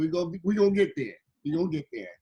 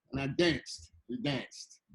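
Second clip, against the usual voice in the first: ordinary talk, nothing marked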